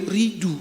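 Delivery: "Ridu" with the vowels of Rudi swapped; mispronounced name. Retold or imitated. Grandparent unable to say Rudi, mispronouncing it as "Ridu". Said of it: "opung saya tak pandai panggil nama saya".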